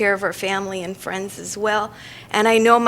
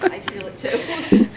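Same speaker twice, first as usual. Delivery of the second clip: whispering; slower than usual. Real, not acted. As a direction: whisper it gently to her. Colleague quietly empathizes.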